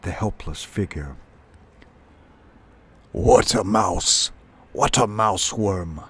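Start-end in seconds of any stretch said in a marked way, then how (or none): 3.1-6.0 rough-voiced sparrow king snapping; irate bird tyrant in broken speech; snapped. A narrator's reading. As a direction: snap it out in slow, forceful bursts. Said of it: "he snapped".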